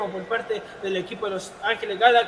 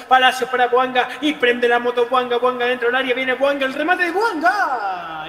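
rising commentator call; live play-by-play; 5 dB louder than usual. Acted. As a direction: build it up with the attack.

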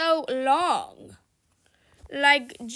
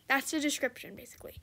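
whisper; 2 semitones lower, 9 dB quieter, faster than usual. Real, not acted. Aside to viewers while gaming.

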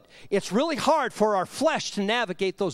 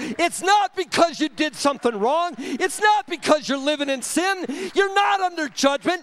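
raised, louder, punched preacher's delivery; full-force preaching; 4 semitones higher, 4 dB louder than usual. Real, not acted. Preacher tells congregation the storm is not judgment, full-force preaching. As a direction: hammer each denial at the crowd.

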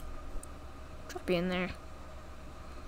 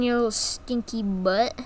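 soft teasing coo; 9 dB quieter than usual. Acted. Teasing game baby.

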